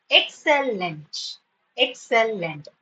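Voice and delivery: "Excellent" drawn out as ek-sel-lent; syllable by syllable. Shown as a demonstration. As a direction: say "Excellent" slowly in three beats. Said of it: "Three syllables".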